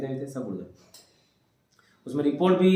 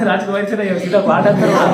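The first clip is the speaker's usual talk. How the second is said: very loud, higher, laughing disbelief; real amused laughter; 5 semitones higher, 12 dB louder than usual. Real, not acted. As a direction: crack up loudly at the absurd claim. Amused by student's rumour. (हँसी में ऊँची आवाज़)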